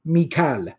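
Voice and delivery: Mikal as mee-KAAL, two syllables; Hijazi pronunciation. Shown as a demonstration. Teacher showing how Quraysh pronounced it, Mikal as mee-KAAL. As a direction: say Mikal with long aa, straight into l. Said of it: "they would pronounce the name".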